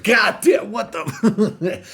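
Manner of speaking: high, loud, drawn-out disbelief; shocked exclaim. Retold or imitated. Reacting to the narrator's story, loud mock shock.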